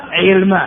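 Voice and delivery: علم as 'ilma; shifted vowel. Shown as a demonstration. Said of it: "علم فيه ثلاث لغات". (علم بكسر العين وتسكين اللام)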